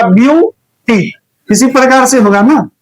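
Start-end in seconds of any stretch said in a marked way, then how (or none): 0.0-1.1 ब्यूटी drawn out slowly as biu-ti; careful pronunciation. Shown as a demonstration. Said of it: "pronunciation कीजिएगा तो कैसे होगा".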